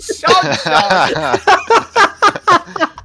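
loud laughing chant; frat bro. Pretend. Chanting the item name.

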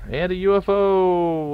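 high, loud, drawn-out call; excited announcement. Real, not acted. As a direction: sing out the sighting, stretching it high and loud. UFO suddenly appears in game.

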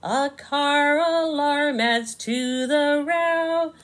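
slow, lilting storybook narration; reading to children. A narrator's reading. Narrator reading Bug in a Rug to kids, slow and sing-song. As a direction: savour each rhyme for little listeners.

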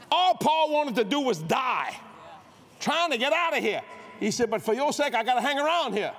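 loud, high preacher's climb; rising sermon shout about Paul and Timothy; preaching at full force. Acted.

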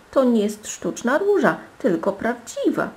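high-pitched tearful complaint; close to tears. A narrator's reading. Princess telling her father the rose is real, close to tears. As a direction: complain to daddy, nearly crying. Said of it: "była bliska płaczu".

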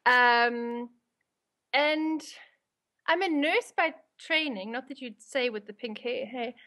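ordinary delivery

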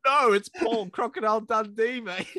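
put-on Australian accent. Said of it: "Paul Hogan is cro".

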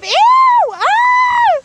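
loud high squeal of disgust; shrieking squeal. Real, not acted. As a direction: let out a piercing shriek. Squeals at a creep.

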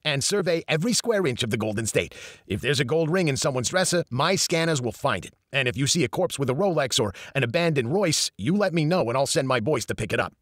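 put-on brash New York accent; a fast-talking New York accent, lightly voiced. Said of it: "Eddie spoke with a strong New York accent".

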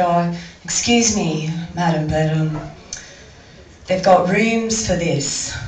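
mock a pompous offended man; puffed up man. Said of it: "this puffed up man came up to her, a bit offended".

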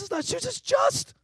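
high, excited rush of discovery; urgent realization. Retold or imitated. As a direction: blurt it out as the penny drops. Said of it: "She says".

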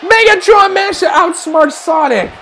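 high-pitched exclaiming commentary; excited play-by-play. Real, not acted. Commentator watching Megatron outsmart Sonic, excited high-pitched call. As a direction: call the escape with rising excitement.

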